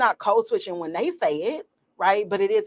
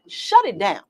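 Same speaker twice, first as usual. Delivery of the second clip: ordinary talk, nothing marked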